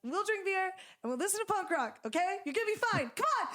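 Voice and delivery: high, pushed-up cheerful friend voice; imitating Vera. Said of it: "And she talks like that".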